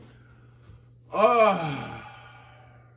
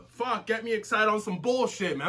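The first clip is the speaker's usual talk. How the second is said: usual speaking voice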